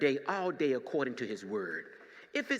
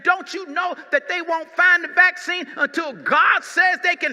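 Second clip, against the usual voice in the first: rising, louder pulpit preaching; building sermon; 13 semitones higher, 13 dB louder than usual. Acted. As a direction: climb higher with each "only one person". Preaching at full force, climax.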